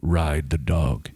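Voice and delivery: low, slow dramatic ad read; deep radio voice. Acted. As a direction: read it like a movie trailer. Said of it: "You do have a radio voice".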